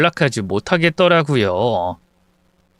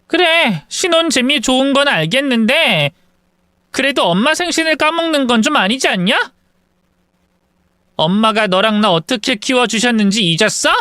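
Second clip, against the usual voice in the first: much higher, mocking reproach; lecturing sister; 8 semitones higher than usual. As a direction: needle the brother about forgetting mom. (남동생을 비꼬며 나무라는 누나, 훨씬 높게)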